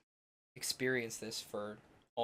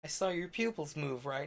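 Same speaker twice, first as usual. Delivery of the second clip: sly explaining; 5 semitones higher, slower than usual. Retold or imitated. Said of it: "And I'm like".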